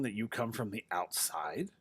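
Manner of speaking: hushed, stunned whisper; a shocked whisper to the stranger. Acted. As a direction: breathe it out in disbelief.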